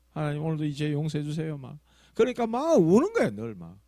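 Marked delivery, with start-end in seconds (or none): 0.1-1.6 mock repentant prayer. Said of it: "저렇게 기도하는갑다".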